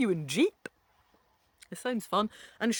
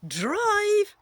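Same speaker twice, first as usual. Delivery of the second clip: Drive, high and louder; surprised; 11 semitones higher, 6 dB louder than usual. Acted. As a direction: say Drive in high shocked disbelief. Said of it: "You'd even have a learner's permit".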